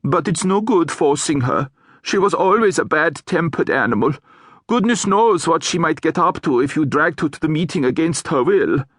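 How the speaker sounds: a hint of a grave troll; troll weighing the trouble, mildly grave; lightly voiced troll. Troll consoling the hag about Gladys, lightly grave.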